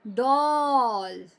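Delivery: डॉल stretched very slowly with a long open ऑ; slow model word. Shown as a demonstration. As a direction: draw out डॉल for the class. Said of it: "फिर है डॉल".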